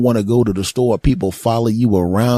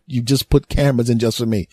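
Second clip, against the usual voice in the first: ordinary talk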